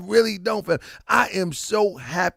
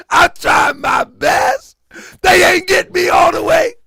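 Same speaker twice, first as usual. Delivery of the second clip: mock sobbing; 12 semitones higher, 8 dB louder than usual. Mock crying over pronunciation.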